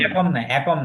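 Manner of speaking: একম said wrongly as "æ-kom"; mispronounced একম. Retold or imitated. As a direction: imitate the wrong একম, opening with an open "æ". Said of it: "একম উচ্চারণটা ভুল".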